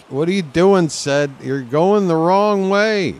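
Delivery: slow, high, loud protest; yelling at the ball. Real, not acted. Player yells at the ball drifting foul, slow and loud. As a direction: drag the words out in a loud complaint.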